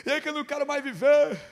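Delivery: high, fast mock despair; hammed-up adolescent misery; pitiful teenage girl. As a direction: imitate a girl whining that all is lost. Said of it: "mas lastimável a situação".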